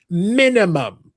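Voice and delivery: minimum stretched very slow; drawn-out emphasis. Pretend. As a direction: drag out minimum for a boast. Boasting about listening speed.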